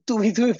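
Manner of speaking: high, loud insistent repeated তুমি; emphatic repetition. Retold or imitated. Teacher acting a confronted person, insistent repeated তুমি. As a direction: hammer তুমি three times in disbelief. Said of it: "বারবার বলাটার কারণটা কি?".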